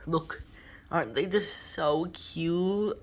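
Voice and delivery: ordinary talk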